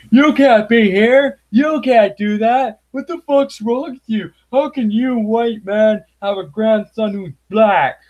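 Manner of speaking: high, loud, slow sneering mock voice. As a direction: bellow it like an outraged cartoon bigot. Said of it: "people going".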